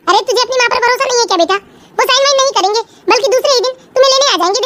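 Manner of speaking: ordinary delivery, nothing marked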